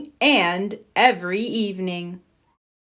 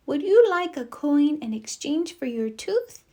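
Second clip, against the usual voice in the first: fairy voice; 5 semitones higher than usual. A narrator's reading. Tooth fairy speaking.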